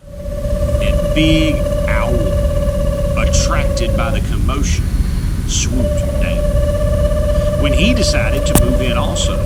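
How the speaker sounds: big loud narration. A narrator's reading. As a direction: boom out the owl's arrival. Owl swoops into the mitten.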